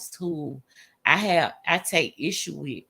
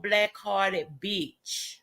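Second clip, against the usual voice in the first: ordinary delivery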